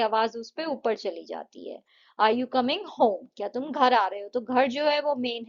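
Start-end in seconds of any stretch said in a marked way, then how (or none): 2.2-3.3 Are you coming home? lifting up on home at the end; slow, clear yes-no question rising on home; rising tune. Shown as a demonstration. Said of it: "हमारी voice ऊपर चली जाती है".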